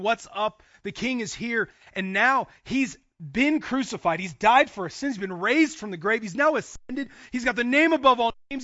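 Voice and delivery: impassioned preaching. Real, not acted. Preacher announcing the Gospel finally being preached, impassioned. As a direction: proclaim it at full force, punching key words. Preacher builds to full force.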